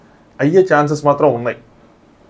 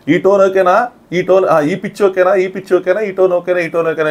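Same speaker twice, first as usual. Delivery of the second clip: high-pitched mock yell, repeated insistently; Nikhil's yelling; 6 semitones higher, slower than usual. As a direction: imitate someone yelling, pitched well up. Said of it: "అని చెప్పి నిఖిల్ అరుస్తూ ఉంటాడు". (నిఖిల్ అరుపును అనుకరిస్తూ, పై స్థాయి గొంతుతో)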